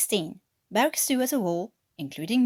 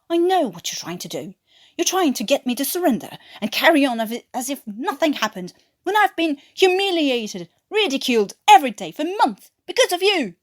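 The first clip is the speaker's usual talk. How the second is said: loud, higher, building to a shout; furious rant; 6 semitones higher, 7 dB louder than usual. Acted. Watkins to Newkirk, loud furious rant. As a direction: unload months of humiliation.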